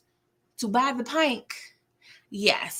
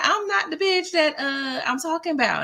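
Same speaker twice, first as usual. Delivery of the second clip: sassy grandma; 8 semitones higher than usual. Retold or imitated. Grandma telling Little Murder she means Clifford, high sassy retort. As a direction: snap it back with grandma sass. Grandma denying she's flirting.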